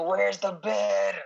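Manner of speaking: drawn-out mock yell; newborn yelling. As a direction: stretch it out like a hollering newborn. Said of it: "I came out yelling and screaming".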